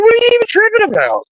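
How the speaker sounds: very high, fast and incredulous; fast, very high disbelieving cry; high-pitched fast outburst. Retold or imitated. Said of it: "I was like".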